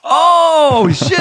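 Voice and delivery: put-on high shocked Thai-accented gasp; wife's Thai accent. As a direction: imitate her sudden alarm in Thai accent. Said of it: "she's like".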